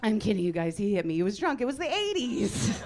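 boisterous backpedalling; loud mock reassurance. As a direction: shout the excuse over the laughter. Mock reassurance shouted over laughter.

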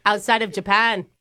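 chummy mock Aussie; put-on Australian accent. Caller flattering the interviewer in a put-on Australian accent. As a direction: lay on the fake Australian. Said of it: "Are you from Australia?".

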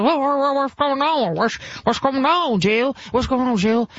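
mock a dazed Joe jolted awake from a nap; groggy old Joe. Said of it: "He was like".

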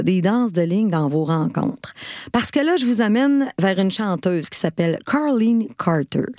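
ordinary delivery

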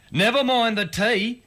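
a touch of beaming pride; mildly beaming Pop. A narrator's reading. Proud husband brushing off tea, lightly beaming. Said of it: "Pop beamed".

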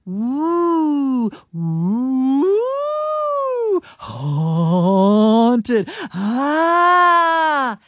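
loud hooting ghost wail. Pretend. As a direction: moan like a cartoon ghost. Reads out AI ghost noises.

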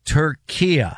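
"Turkey" pronounced like Türkiye; Turkish-style country name. Shown as a demonstration. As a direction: say Turkey as three syllables, "tur-KEE-yeh". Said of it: "Turkey's not Turkey".

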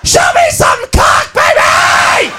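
slow, raw party scream; hammed-up wild yell. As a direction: bellow it wildly at the stripper. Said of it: "She's going".

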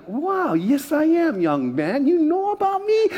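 high, slow pleased gushing; proud delight. Retold or imitated. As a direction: preen at being recognised. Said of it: "I'm like".

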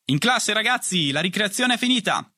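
high, carrying call; called announcement. A narrator's reading. Priest announcing recess is over, high calling voice. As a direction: summon the pupils from across the yard. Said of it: "la voce di padre Michel annunciasse".

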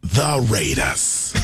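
Berman impression. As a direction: boom it out like a highlights host. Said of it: "when you're doing your Berman".

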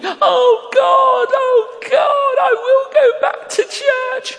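high, loud imitated wail; mock weeping. Driver breaking down as he is prayed for, exaggerated weeping. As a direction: wail it out like an overcome, crying man. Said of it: "he starts crying. He's weeping".

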